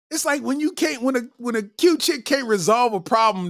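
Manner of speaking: ordinary talk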